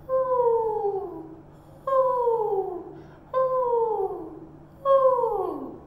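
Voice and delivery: high breathy wind whooshes; wind whoo sounds. Shown as a demonstration. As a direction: sing out the wind, ooh ooh. Teacher voicing wind for scarf.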